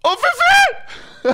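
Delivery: very high, slow cheering call; excited drawn-out shout. Real, not acted. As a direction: stretch the name out in joy. Teammate escapes at the end.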